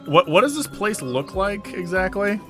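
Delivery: ordinary delivery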